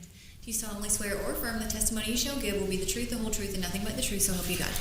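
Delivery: fast rattled-off oath; rote court oath. Real, not acted. Clerk reading the oath.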